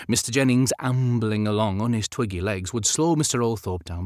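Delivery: usual speaking voice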